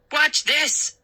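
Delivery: high excited show-off call. A narrator's reading. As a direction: brag before the kick. Showing off a karate kick.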